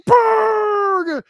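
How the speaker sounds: high, drawn-out goal-call shout; imitated commentator's long, loud cry; Cooper Brooks. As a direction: belt out the name like an announcer. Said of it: "Cooper Brooks say".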